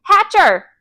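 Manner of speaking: loud, high indignant shout; booming. A narrator's reading. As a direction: boom out the name in alarm. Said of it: "Mister Yarby boomed".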